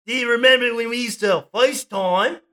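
high, put-on Aussie greeting; hammed-up Australian mate on the phone; Australian accent. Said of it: "Oh, wait, we're Australian".

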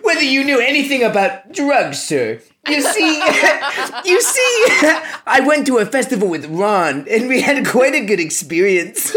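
reading Harry's line while cracking up; laughing through it. Retold or imitated. Reading the book aloud to friends, laughing through Harry's line. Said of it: "I asked".

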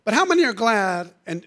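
normal talking voice

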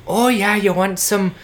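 high, put-on Irish bar worker accent. Podcast host imitating Shangela's Irish-sounding Miss Cleo, Irish accent. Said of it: "Just like".

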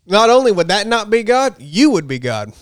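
normal talking voice

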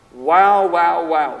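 loud, amazed exclamation; stunned wow; awed wow. Real, not acted. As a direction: let it hit you. Reacting to God's answer.

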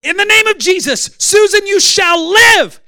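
high, full-force shouted command; commanding faith shout. Retold or imitated. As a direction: bellow it like a preacher raising the dead. Reenacts his command raising the woman.

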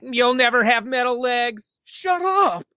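high, far-off robotic taunt; mock the guy like a deriding robot. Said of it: "a robot voice would, like, deride him".

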